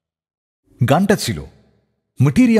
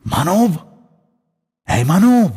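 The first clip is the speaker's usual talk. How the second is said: raised call from behind in the dark; calling out a name; 4 semitones higher, slower than usual. Retold or imitated. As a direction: call the name out to get his attention. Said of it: "পেছন থেকে ডেকে উঠল".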